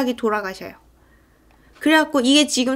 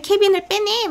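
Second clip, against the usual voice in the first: high, acted-out crowd shout; mock urgent cry; 9 semitones higher than usual. Townspeople call to get Kevin away, mock urgency. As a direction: imitate alarmed onlookers, pitch jumping up.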